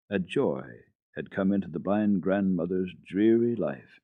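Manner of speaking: plain reading voice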